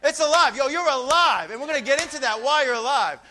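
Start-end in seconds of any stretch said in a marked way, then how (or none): 0.0-1.5 loud, high wake-up call to the church; preacher shouting at the church to wake up; rousing shout. Real, not acted. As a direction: jolt the sleepy congregation awake. Preacher rouses the congregation.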